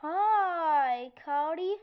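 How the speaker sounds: loud, stretched-out sugary greeting; syrupy, lovestruck hello; honey voice. Shown as a demonstration. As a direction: demonstrate a sweet, sappy flirting voice. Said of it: "That was honey voice".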